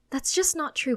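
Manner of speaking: firm but calmer plea. A narrator's reading. Mother apologising to scared child, calmer but insistent. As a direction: ease off the shout, still emphatic. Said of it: "she says in a calmer voice".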